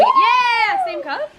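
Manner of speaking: excited. Real, not acted. Cheering the pong game.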